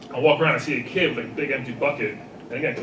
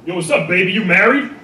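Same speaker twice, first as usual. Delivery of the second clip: catcalling guy; 6 semitones higher, 7 dB louder than usual. Retold or imitated. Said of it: "he looks at the girl and he goes".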